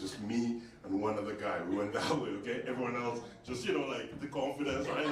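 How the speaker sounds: ordinary talk, nothing marked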